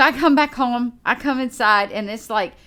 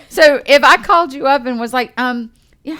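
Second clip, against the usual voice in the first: usual speaking voice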